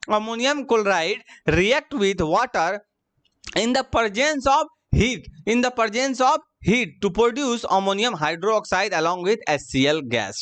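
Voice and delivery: very slow, word by word; dictating a chemical equation to students; slow dictation. Shown as a demonstration. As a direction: read it out slowly for notes. Teacher reads the reaction aloud.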